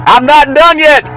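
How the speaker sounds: loud, high, insistent call; comic high-pitched insistence; hollering pitchman. Pretend. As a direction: shout it like a fired-up pitchman.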